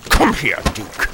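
normal talking voice

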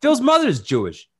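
put-on high, loud kvetching Yiddish accent. Mock-offended rant about Phil's list.

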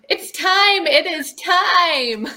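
loud, high, laughing delight; excited. Real, not acted. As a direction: say it slowly with a big laugh. Guest excitedly answers with laughter.

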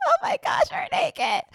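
mother finding her daughter naked on the stretcher, shrieking in shock. Retold or imitated. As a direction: shriek it in surprise. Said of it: "she was like".

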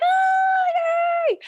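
very high shouted yay; mom's shriek of joy. Retold or imitated. Mom hearing her daughter got the job, shouted very high yay. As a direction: scream it like a mom overjoyed.

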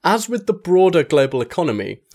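usual speaking voice

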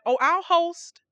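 volunteer eagerly; eager offer. Retold or imitated. Martha offering to host Jesus, eager. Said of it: "She said".

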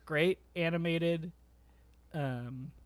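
ordinary talk, nothing marked

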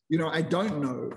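normal talking voice

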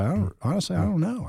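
high, bewildered protest; baffled. Retold or imitated. Driver protesting to the cop, high and baffled. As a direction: pitch way up in disbelief. Retold traffic stop, own reply.

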